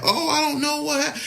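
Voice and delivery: very high put-on voice; high-pitched mimicry of someone clueless; mock helplessness. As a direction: squeak it out as a mocking imitation. Mimics clueless reaction, cut off.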